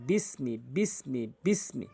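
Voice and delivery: slow, syllable by syllable recitation; drawing out each syllable for learners; slow drilled reading. Shown as a demonstration. Quran teacher drilling students, slow careful recitation. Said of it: "এভাবে একটু পড়বেন কষ্ট করে".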